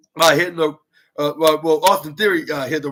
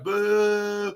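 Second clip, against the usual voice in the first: long drawn-out boo, held higher; imitated crowd boo; 5 semitones higher than usual. Fans answering the wrestler's taunt, mock booing. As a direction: mimic booing fans on one held note. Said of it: "that's where fans like".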